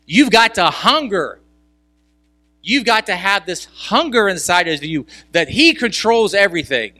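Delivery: preaching at full force. Acted. Pastor urging the congregation, preaching at full force.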